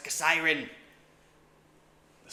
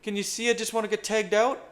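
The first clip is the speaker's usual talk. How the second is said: usual speaking voice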